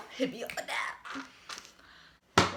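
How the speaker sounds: whispering. Real, not acted. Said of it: "That scared me".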